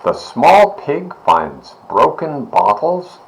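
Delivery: ordinary delivery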